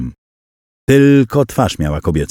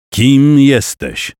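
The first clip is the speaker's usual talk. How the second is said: raised, slow stern demand; 6 semitones higher, 3 dB louder, much slower than usual. A narrator's reading. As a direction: demand an answer sharply. Jakub confronts the creature. (surowo, wolno i wyżej)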